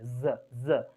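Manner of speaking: ज as a buzzing "zuh"; vibrating Z. Shown as a demonstration. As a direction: sound out ज as Z with vibration. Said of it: "vibration होता है उस line में".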